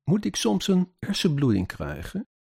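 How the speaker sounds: high-pitched indignant question aloud; speaking out aloud. A narrator's reading. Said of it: "Ik zeg hardop in de woonkamer". (hardop, met hoge stem)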